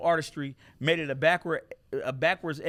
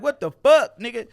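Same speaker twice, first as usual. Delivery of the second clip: high, loud stunned outburst; loud, high shocked cry; shocked yell; 7 semitones higher, 11 dB louder than usual. Retold or imitated. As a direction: blurt it out in disbelief. Retold shock at misspelled tattoo.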